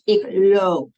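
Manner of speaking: "loop" with a long, held Dutch O, like "lope"; long o sound. Shown as a demonstration. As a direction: hit "loop" loud and hold the O. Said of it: "to hear that long sound".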